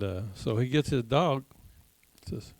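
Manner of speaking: ordinary talk, nothing marked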